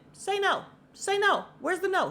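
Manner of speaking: high, loud, punchy pleading; urgent coaxing. Retold or imitated. Viewer urging the interviewee to deny it, high urgent coaxing. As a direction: squeal at the screen for an answer. Acts out urging her at screen.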